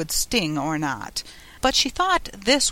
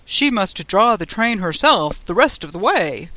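loud passenger voice; another passenger; 6 dB louder than usual. A narrator's reading. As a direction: pipe up loudly as another traveller. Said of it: "other voices went on".

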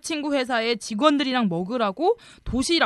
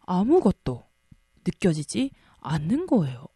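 ordinary talk, nothing marked

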